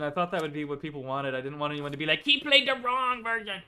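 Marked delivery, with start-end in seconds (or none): 2.2-3.6 high, drawn-out mock whine; whiny mock complaint. Streamer mimicking viewers who wanted another version, whiny mock complaint. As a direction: mimic a grumbling fan, higher and slower.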